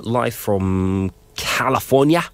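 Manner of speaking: a stray, drawn-out Australian accent; a slow, accidental Australian accent. Real, not acted. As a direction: slip into a lazy Australian accent. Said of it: "in an Australian accent".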